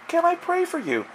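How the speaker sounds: high, small voice imitating a girl; quiet young girl. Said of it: "in a very quiet voice".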